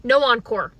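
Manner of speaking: usual speaking voice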